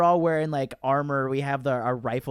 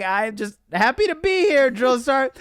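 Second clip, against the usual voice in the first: much higher, louder mock-innocent voice; sweet-talking the drill sergeant in a high, loud voice; innocent private; 10 semitones higher, 6 dB louder than usual. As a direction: play the wide-eyed recruit, piping up.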